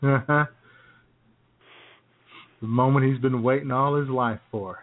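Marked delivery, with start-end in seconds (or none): none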